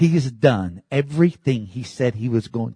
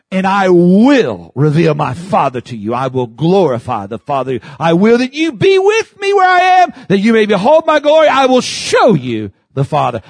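rising, louder preacher's voice quoting the Lord; preaching; 7 semitones higher, 8 dB louder than usual. Acted. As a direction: proclaim it with growing fervour. Preaching the Lord's words.

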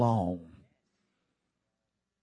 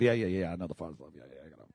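lower, much softer yeah yeah, I know; dismissive brush-off; 10 semitones lower, 10 dB quieter, much faster than usual. Retold or imitated. Preacher imitating a friend, dismissive brush-off. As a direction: wave it off as old news. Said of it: "like everybody does".

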